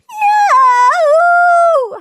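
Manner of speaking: long, very high, loud howl. A narrator's reading. Peter answering Henry's accusation, howling. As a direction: howl it out like a wounded child. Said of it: "howled Peter".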